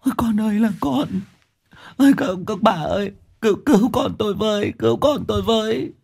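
high, keening wail of a bereaved mother; self-pitying crying. Acted. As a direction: plead for help in a thin high sob. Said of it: "thì tủi thân khóc".

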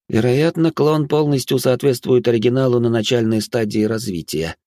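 ordinary delivery

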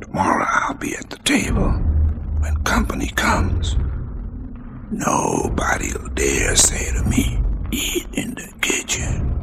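slow breathy whispered recitation; hushed poetry reading over music; whispered poem. Acted.

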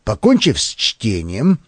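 ordinary delivery

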